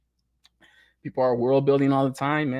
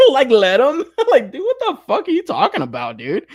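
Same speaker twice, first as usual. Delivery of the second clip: laughing; 11 semitones higher, 5 dB louder than usual. Real, not acted. Laughing rant at complainers.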